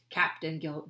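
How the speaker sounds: ordinary delivery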